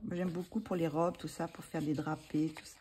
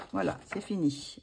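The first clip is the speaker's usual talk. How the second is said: usual speaking voice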